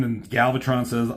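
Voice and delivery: ordinary delivery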